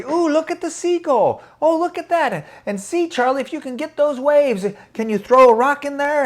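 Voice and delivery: high, delighted exclaiming; excited delight. Acted. Older women point out seagulls and waves to the boy, excited. Ladies now happily having fun.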